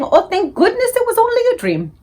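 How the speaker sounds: very high, relieved sigh of words; relieved dreamer. Acted. BFG mimics a waking dreamer, very high and relieved. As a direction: sound hugely relieved. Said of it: "you's saying".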